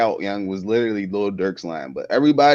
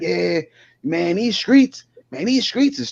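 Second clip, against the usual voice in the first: lightly imitated weird talker, pitched higher; a higher, mildly mocking imitation of his talk; 7 semitones higher, slower than usual. Podcaster mimics man from the video, weird talk. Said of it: "he talk real weird as hell".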